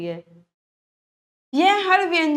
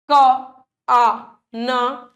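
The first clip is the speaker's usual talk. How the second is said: sounded out letter by letter; 5 dB louder, much slower than usual. Shown as a demonstration. Teacher spelling out क आ न for pupils, drawn out. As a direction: slowly spell out क आ न, each sound held apart. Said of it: "लिखकर सीधे का".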